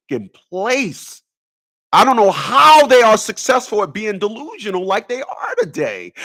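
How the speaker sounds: usual speaking voice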